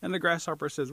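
usual speaking voice

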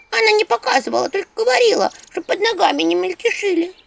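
high embarrassed imp voice. Acted. Imp Anchutka embarrassed she doesn't know. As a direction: admit it sheepishly.

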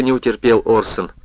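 usual speaking voice

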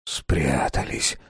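slow frightened whisper. A narrator's reading. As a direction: breathe it out, barely voiced, slowly. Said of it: "зашептал старик". (испуганный шёпот)

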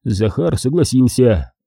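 ordinary delivery, nothing marked

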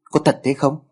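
a touch of alarm in the question; startled question. A narrator's reading. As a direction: ask it a little startled, like a scared kid. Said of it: "Giọng cậu ta nghe thảng thốt". (hơi thảng thốt)

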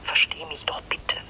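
slow, whispered pleading; wife pleading with husband, whispered; whispered plea. Acted. Pleading on the phone.

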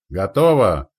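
loud call from a gunner; shouted reply. A narrator's reading. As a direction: shout that the job is done. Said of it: "крикнул тот".

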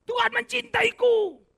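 high, slow, put-on voice for "Tuhan mencintaiku"; mock sing-song claim. Preacher mimics a smug believer's high, drawn-out claim. Mocking a self-assured claim.

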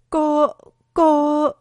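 narrated panicky stammer; trembling voice. A narrator's reading. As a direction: stammer it out in fear. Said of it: "nói với giọng run rẩy".

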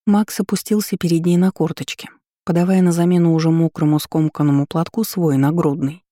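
ordinary delivery, nothing marked

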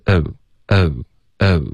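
British "哦" glide with a relaxed schwa start; a small, loose glide from uh, each said apart; relaxed-onset British O vowel. Shown as a demonstration. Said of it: "它的开头其实是比较放松的".

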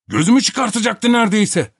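high, loud, wounded protest; aggrieved complaint. A narrator's reading. Topak showing his nearly gouged eye, aggrieved. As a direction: complain as the injured party.

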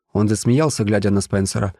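plain reading voice

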